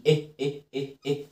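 repeated short, separate "إيه" as in bed; short e sound. Shown as a demonstration. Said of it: "بتعمل شورت إي".

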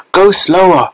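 high, strained plea to slow down; mildly panting. A narrator's reading. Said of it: "panted Missus Salt".

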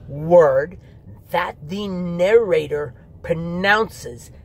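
slow, every single word punched; overemphasizing every word. Shown as a demonstration. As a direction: hit each word as if it matters most. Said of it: "I can't even continue to do that".

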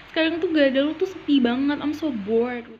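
ordinary delivery

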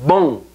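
BOM with nasal o, m almost lost; nasalized o. Shown as a demonstration. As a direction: nasalize the o in BOM, barely closing the m. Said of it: "o se nasaliza completamente y la M es casi que se pierde".

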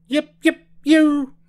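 very high, loud yips; whooping yells. A narrator's reading. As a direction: whoop in short high bursts. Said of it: "terrible yells".

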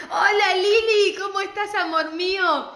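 greeting her favourite supplier with a squeal; gushing greeting. Real, not acted. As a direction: squeal it out with delight.

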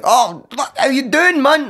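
high-pitched startled greeting; acting out amazement, pitch jumping up; shocked. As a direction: blurt it out, taken aback. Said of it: "Like, I was shocked".

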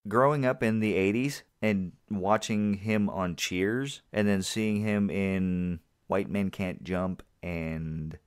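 ordinary delivery

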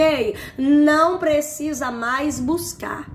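ordinary talk, nothing marked

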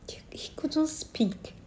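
barely breathed, soft whisper; hushed whisper. Retold or imitated. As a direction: drop to a whisper as if not to disturb. Retelling father unable to speak.

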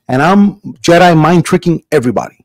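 ordinary delivery

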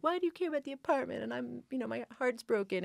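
high-pitched mock teenage whine; whiny teenage protest. Teen daughter snapping at her mom on the phone, high whine. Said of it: "I was like".

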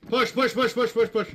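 shouting. Real, not acted. Urging squad forward in firefight.

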